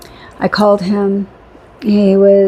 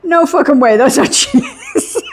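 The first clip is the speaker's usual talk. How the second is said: higher mock disbelief, breaking into laughter; mock incredulity through giggles; incredulous, laughing imitation; 6 semitones higher, slower than usual. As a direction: retell his disbelief, drawing out the end as laughter takes over. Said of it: "just the way he said it".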